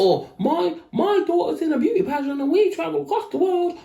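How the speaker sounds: very high, gushing put-on mum voice; high-pitched parody of a proud mother; mock social-media mum. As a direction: imitate a bragging pageant mum. Mimicking social media mums.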